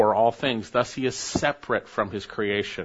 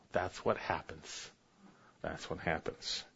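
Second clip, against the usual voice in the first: much softer, almost breathed; hushed; 7 semitones lower, 14 dB quieter than usual. Acted. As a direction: drop to a hush. Preacher drops voice to near hush.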